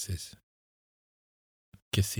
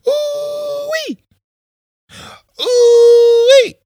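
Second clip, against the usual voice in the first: piercing high "ooh-wee" wail; long shrieked alarm cry, very high; loud wail; 17 dB louder, much slower than usual. A narrator's reading. Woman wailing to raise the alarm, piercing cry. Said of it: "A loud wail cut through the air".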